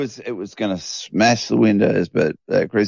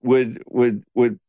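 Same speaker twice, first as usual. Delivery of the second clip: ordinary delivery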